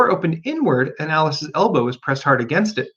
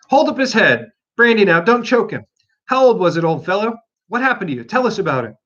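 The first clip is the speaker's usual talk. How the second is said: animals fussing; 6 semitones higher, 4 dB louder than usual. Acted. Animals tending Bill.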